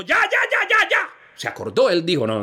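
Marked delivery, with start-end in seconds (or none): 0.0-1.0 high quick cries to stop. Acted. The judge stops the two mothers-in-law, high. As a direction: cut them off high.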